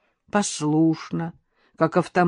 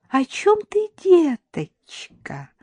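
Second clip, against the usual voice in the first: high, sugary, drawn-out question; honeyed; 11 semitones higher, much slower than usual. A narrator's reading. Mother-in-law playing innocent to Karina, honeyed. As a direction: coo it innocently, stretching the endearment. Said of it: "прежним медовым голосом осведомилась Шурочка".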